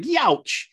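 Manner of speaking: high-pitched comic yelp; hammed-up cartoon ouch; mock pained yelp. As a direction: yelp as if playfully nipped. Acts out comic character bitten.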